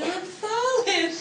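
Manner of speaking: high, slow, drawn-out Southern belle wail; Southern accent. Retold or imitated. Scarlett O'Hara toppling over on stage, Southern accent. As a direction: stretch it out in a lilting Southern drawl. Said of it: "And I kept my Southern accent".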